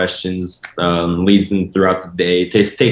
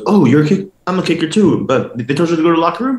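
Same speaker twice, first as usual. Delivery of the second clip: higher, fast, surprised football player voice; a player pitched up, surprised and quick; 7 semitones higher, faster than usual. Retold or imitated. Football player surprised the kicker was sent to the locker room. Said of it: "And the guy goes".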